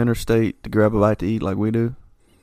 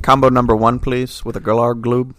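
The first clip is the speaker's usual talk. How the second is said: alien ordering; 3 semitones higher, 5 dB louder than usual. Pretend. Alien ordering fast food.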